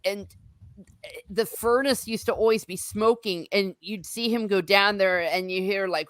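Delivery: ordinary talk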